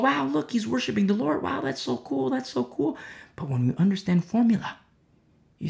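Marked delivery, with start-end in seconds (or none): 0.0-3.0 high-pitched, light imitation of impressed onlookers; mock admiration. Preacher imitating people watching Saul worship, mock-admiring. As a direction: pitch it up like an easily impressed bystander.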